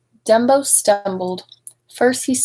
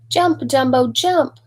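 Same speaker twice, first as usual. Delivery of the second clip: rising, higher calls to jump; clowns shouting; 4 semitones higher, slower than usual. Acted. Clowns calling up to Dumbo in the burning house, higher shouts. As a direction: urge him on from below. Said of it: "shouted the clowns".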